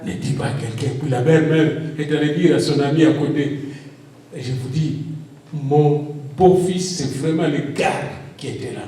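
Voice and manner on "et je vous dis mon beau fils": usual speaking voice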